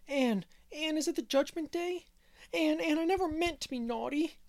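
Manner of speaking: put-on high, loud wail of a scared little boy; frightened child howling. A narrator's reading. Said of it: "had howled".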